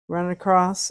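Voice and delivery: normal talking voice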